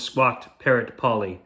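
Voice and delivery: usual speaking voice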